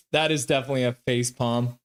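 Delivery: ordinary delivery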